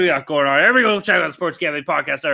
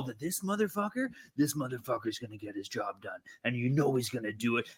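much softer, conspiratorial growl of praise; low intense hush; 7 semitones lower, 15 dB quieter than usual. Real, not acted. Fan to co-hosts, hushed intense praise. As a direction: lean in and drop it way down. Hyping the kicker, dropping voice.

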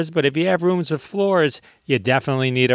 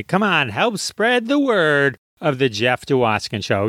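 normal talking voice

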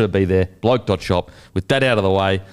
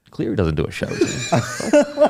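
laughing disbelief; 12 semitones higher than usual. Retold or imitated. Laughing while retelling a gym encounter.

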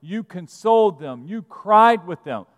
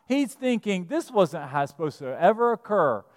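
usual speaking voice

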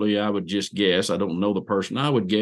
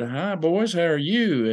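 put-on brighter, higher hello to children; friendly greeting to kids; 7 semitones higher, slower than usual. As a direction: greet small boys kindly.

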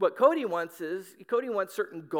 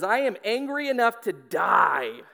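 loud, slow, hammed-up outrage; overdramatic anger; 7 dB louder than usual. Jonah answering God about the vine, overdramatic anger. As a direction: declare it like a drama queen, stretching it out. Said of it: "God, he's so dramatic".

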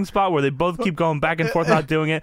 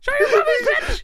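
mock heckle. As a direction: shout it like a crude heckler. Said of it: "continuing heckler impression".

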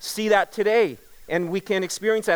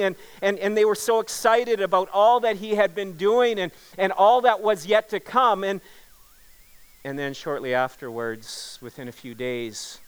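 ordinary delivery

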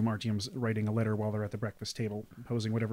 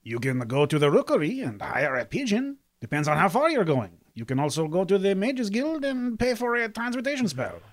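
higher, lilting character voice; helpful Jean-Pierre; 8 semitones higher, 6 dB louder than usual. Acted. Jean-Pierre advising Artyom on sending letters, high helpful voice. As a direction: explain the options in a raised helpful voice.

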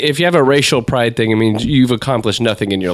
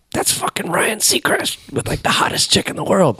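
amazed whisper; 7 semitones higher, 4 dB quieter than usual. Retold or imitated. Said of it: "I'm like".

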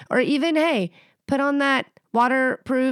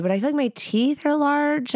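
ordinary delivery

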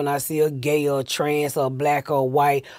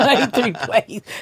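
normal talking voice

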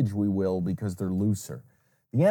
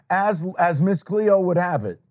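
ordinary talk

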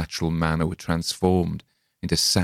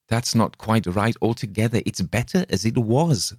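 higher, lisped and hissed; lisping hiss; 4 semitones higher than usual. Acted. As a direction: lisp and hiss it like a fiend. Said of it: "Thus lisped and hissed Coppelius".

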